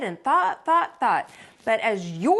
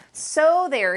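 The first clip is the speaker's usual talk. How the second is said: a slightly louder, higher retort; mild holler; 5 semitones higher, 4 dB louder than usual. Acted. Boy in the brambles throws Plug and hollers a gentle so there. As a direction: snap it back in triumph. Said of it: "he hollered".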